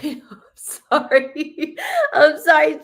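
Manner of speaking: helpless laugh, voice lifting; laughing apology. Real, not acted. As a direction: apologize through a teary laugh. Breaks into emotional laugh while apologizing.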